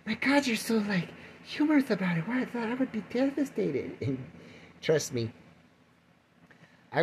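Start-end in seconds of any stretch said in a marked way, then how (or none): 0.1-4.0 mock listener voice. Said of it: "people are listening going".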